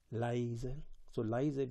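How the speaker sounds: soft, stealthy narration; very quiet, suspenseful telling; hushed. A narrator's reading. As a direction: tiptoe softly through the words.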